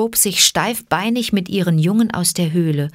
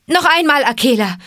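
high, loud call to a companion; urgent raised-voice cry; shouted command; 8 semitones higher, 4 dB louder, faster than usual. A narrator's reading. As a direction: yell the order across the herd. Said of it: "schrie er".

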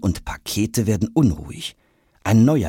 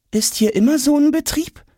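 put-on boy Jonas, amazed; 3 dB louder than usual. A narrator's reading.

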